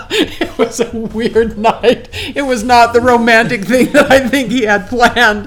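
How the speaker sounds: loud, high talk through real laughter; laughing. Real, not acted. Woman recalls the failed romantic night, really laughing. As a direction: keep talking while cracking up. Cracking up at her own story.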